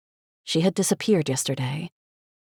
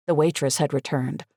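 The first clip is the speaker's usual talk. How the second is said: ordinary delivery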